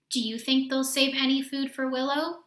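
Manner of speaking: usual speaking voice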